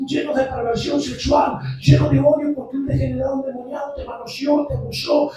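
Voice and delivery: loud, rising fervent sermon cadence; preaching at full force. Acted. Pastor preaching to the church, fervent and loud. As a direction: drive each line harder at the congregation.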